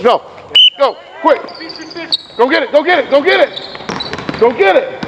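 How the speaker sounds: high, urgent coaching calls; calling out commands. Real, not acted. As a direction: spur the players on from the sideline. Coach shouting drill commands.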